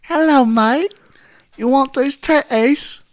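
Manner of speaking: high, put-on British stripper voice; British accent. Co-host auditioning as Australian stripper, comes out British accent. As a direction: attempt Australian, land in British. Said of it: "That's British".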